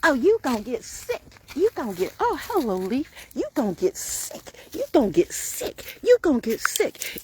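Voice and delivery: high, lilting mock warning; singsong tease. Teasing the person near her in a high singsong. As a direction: tease like a nagging mother. Teasing someone about getting sick.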